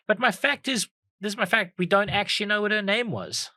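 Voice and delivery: high, eager announcement; excited reveal. Real, not acted. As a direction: proudly unveil the big fact. Revealing her fact excitedly.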